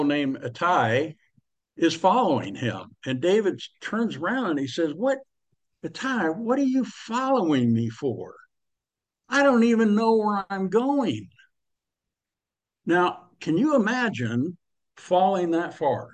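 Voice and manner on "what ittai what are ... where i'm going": David's baffled question. Retold or imitated. David turning to Ittai.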